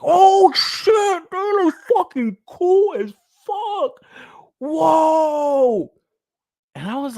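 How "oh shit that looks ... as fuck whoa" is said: high, drawn-out mock crowd excitement; hammed-up, stretched-out awe; mock hype. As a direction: imitate fans losing their minds. Mimics hyped crowd's amazed reaction.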